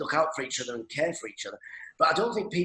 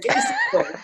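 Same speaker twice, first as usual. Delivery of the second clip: very high squeal through laughter; laughing squeal; 5 dB louder than usual. Retold or imitated. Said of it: "I'd be saying".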